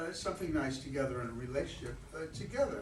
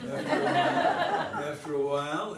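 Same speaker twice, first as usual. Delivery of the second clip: laughing; 3 semitones higher, 7 dB louder than usual. Real, not acted. Laughing mid-line flirtation.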